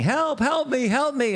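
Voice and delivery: high-pitched cry for help; mock scream. Man trapped in a well calls for help, mock screaming. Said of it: "He's screaming".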